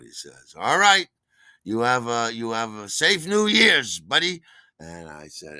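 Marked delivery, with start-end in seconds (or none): 0.6-4.4 high, loud, hearty send-off; Terry. Retold or imitated. Bartender seeing off a regular, loud and hearty. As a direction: stretch out a big friendly farewell. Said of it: "our super friendly bartender".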